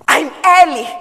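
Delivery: high, loud burst of eagerness; excited young writer arriving early; eager excitement. Acted. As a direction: announce it with keen anticipation. Eager girl awaiting first client.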